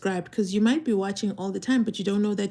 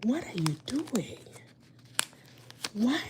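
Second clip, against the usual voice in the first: embarrassed whisper; embarrassed whispered aside; 11 dB quieter than usual. Retold or imitated. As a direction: hiss it under your breath in embarrassment. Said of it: "I was like".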